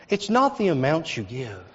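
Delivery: usual speaking voice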